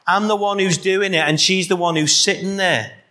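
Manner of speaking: annoyed complaint. Acted. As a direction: whine it out as irritated Martha. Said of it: "Can you see that sort of angry spirit about it?".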